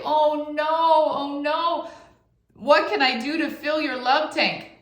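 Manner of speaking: high, hammed-up oh no; mock alarm. Mom answering her child with playful alarm. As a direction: play up the alarm for the kids.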